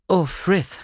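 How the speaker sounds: a startled, awkward greeting to the butler; embarrassed. Acted. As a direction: blurt it out in embarrassment. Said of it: "sagte ich verlegen".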